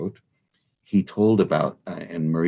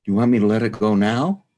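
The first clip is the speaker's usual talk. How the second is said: higher, louder, quick question; disembodied voice; 7 semitones higher, 7 dB louder, faster than usual. Retold or imitated. Voice returns while they are working, calling out. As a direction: call out as the unseen voice. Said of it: "here the voice again says".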